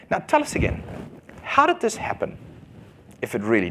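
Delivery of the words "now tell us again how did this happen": much higher, sneering question; a falsetto jeer of disbelief; mocking Pharisees. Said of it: "here they are saying to him".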